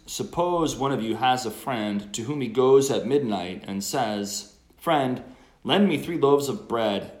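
loud, measured scripture reading; gospel proclamation. Acted.

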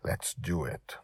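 soft, hushed agreement; subdued, near-whispered resolve. A narrator's reading. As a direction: say it quietly, as a secret pact. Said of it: "I whispered".

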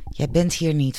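usual speaking voice

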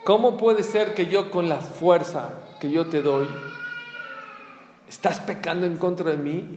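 loud, high, indignant divine voice; a hint of God's indignant reproach; God rebuking. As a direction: thunder down at the sinner like God. Voicing God rebuking the sinner.

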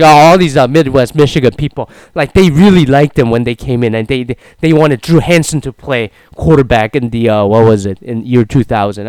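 loud, exaggerated complaining fan voice; mocking critics. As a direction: ham up the whiny critics. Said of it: "It's like".

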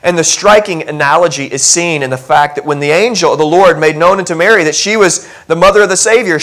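ordinary talk, nothing marked

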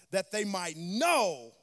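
slow down and land hard on "know"; stress on know. Shown as a demonstration. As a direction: lean into a long, stretched "know". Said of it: "Emphasis on the word know".

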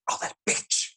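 lightly voiced nan scolding the TV; indignant nan. Nan reacting to Desperate Housewives, mock indignant. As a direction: mimic an outraged nan muttering at her show. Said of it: "after you hear your nan say a few animal names".

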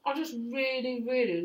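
talking with a mouthful; words around a bite of food; mouth full. Real, not acted. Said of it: "sorry for talking with my mouth full".

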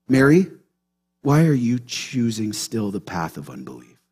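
low, hushed, searching question; gentle correction. Acted. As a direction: ask softly, as a tender reproach. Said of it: "They're just gently correcting her".

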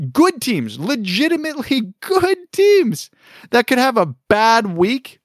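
high-pitched indignant emphasis; exasperated disbelief. Real, not acted. Incredulous rant about the lottery.

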